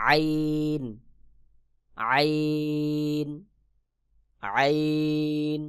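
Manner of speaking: আইন drawn very long from the middle of the throat; drawn-out letter demo. Shown as a demonstration. Teacher showing students the throat letter আইন. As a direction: stretch out আইন slowly like a Quran teacher. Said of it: "যেমন".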